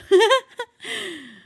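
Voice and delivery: loud amused laugh. Real, not acted. Cat hissed at own sneeze.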